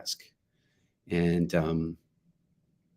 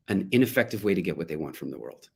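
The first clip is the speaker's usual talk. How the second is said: ordinary delivery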